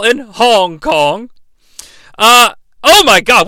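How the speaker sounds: ordinary talk, nothing marked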